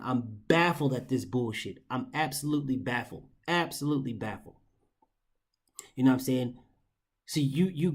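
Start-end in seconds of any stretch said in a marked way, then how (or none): 0.0-4.6 quiet, stunned disbelief; softer, lower, dumbfounded; baffled. Real, not acted. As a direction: trail off in real bafflement. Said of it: "I am fucking baffled".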